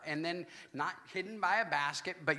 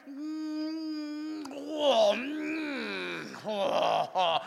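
savoring moans; 6 semitones higher, much slower than usual. Retold or imitated. Savoring a remembered bite.

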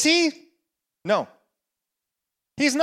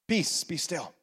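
sudden hush; 5 semitones lower, 9 dB quieter than usual. Acted. Pastor answering the big storm, suddenly soft and low. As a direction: drop to a still whisper of peace.

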